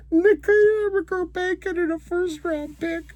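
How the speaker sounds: very high, squeaky voice breaking into laughs; helpless laughter. Real, not acted. Mocking a first round pick who lost, helplessly laughing. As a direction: squeal it through tears of laughter.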